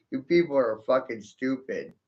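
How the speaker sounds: normal talking voice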